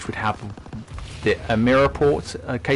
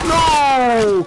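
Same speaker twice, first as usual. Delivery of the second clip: high, loud dismayed no; shocked cry; 6 dB louder than usual. Real, not acted. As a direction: yelp it out in dismay. Sudden death in game.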